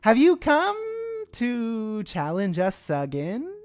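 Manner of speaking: high, very slow, loud insect voices; chorus of bug voices. Acted. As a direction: stretch the words like eager giant ants. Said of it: "four or five different bug voices at once".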